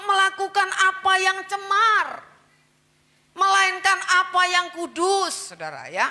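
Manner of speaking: high, loud sermon, each word driven hard; fiery preaching. Acted. Preacher urging the church to be holy, loud fiery sermon. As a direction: preach it to the congregation with fire.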